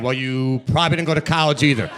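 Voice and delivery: ordinary talk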